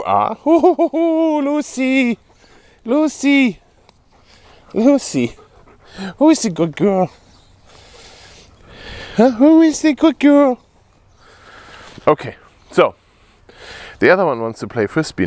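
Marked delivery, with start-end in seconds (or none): 0.5-5.4 much higher, louder sing-song calling of the name; high dog cooing. Real, not acted. Owner coos the dog's name after training, high sing-song. 6.2-10.6 high, louder cooing, slowing on the second ask. Real, not acted. Owner praises her dog in high baby talk.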